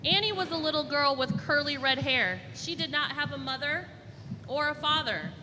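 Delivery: put-on bright, clear storybook reading for little kids; lively picture-book read-aloud; reading to children. Librarian reads picture book aloud.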